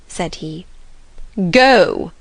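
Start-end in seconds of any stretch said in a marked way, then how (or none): 1.5-2.1 a curt, louder order; a touch of imperious snapping; sharp command. A narrator's reading. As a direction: dismiss the footman with one word. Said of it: "ejaculated Miss Ingram".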